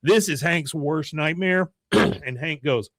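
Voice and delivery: normal talking voice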